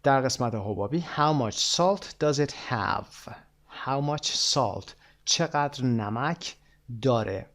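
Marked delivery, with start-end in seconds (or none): 1.2-4.9 slow model reading. Shown as a demonstration. Teacher models dialogue phrase.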